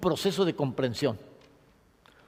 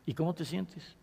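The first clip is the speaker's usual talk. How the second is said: soft therapist question; 11 dB quieter than usual. Acted. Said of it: "Pues, tu papá murió".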